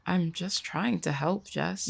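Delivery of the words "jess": ordinary talk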